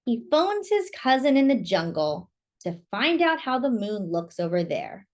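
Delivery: ordinary delivery, nothing marked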